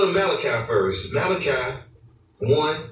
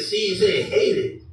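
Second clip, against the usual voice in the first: mocking quote; 5 semitones higher, slower than usual. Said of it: "asshole the other day said".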